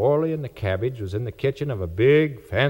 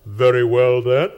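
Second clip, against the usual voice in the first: a louder, commanding voice; strange voice; 5 dB louder than usual. A narrator's reading. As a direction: grant the wish with authority. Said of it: "says the voice".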